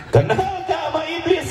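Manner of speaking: a piercing high shout to the room; high shouted question. Acted. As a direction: yell the question out like a crowd-rouser. Preacher acts out loud shouting questioner.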